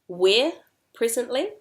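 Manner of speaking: WHERE and PRESENTLY both rising; posing a question. Shown as a demonstration. Teacher runs through the sequence, questioning rise on PRESENTLY. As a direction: ask it, lifting the end of "presently". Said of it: "Posing the question".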